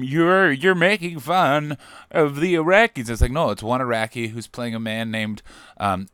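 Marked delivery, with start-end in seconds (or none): 0.0-3.1 mock complainer. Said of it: "lodge complaints going".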